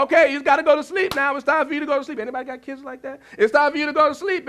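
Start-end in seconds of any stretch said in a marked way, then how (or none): 0.0-2.2 parent telling off. Retold or imitated. Parent sending kids to bed. 3.4-4.5 parent telling off. Retold or imitated. Parent repeating bedtime order.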